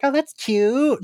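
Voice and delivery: high, slow charmed coo. Retold or imitated. Fan reacting to a cute catchphrase, cooing high and slow. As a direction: coo it like something adorable. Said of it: "I was like".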